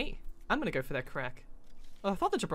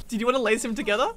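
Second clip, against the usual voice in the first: ordinary delivery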